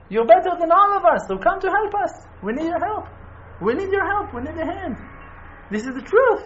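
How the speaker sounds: high, loud pleading preacher voice; rally them, urging them to come; fervent plea. Real, not acted. Pleading call to come to Israel.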